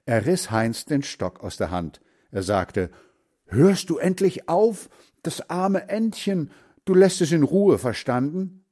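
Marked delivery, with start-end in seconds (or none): 3.5-8.6 high-pitched angry scolding; furious. A narrator's reading. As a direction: scold indignantly, voice raised high. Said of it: "Da wurde Hans Frieder wütend". (wütend schimpfen)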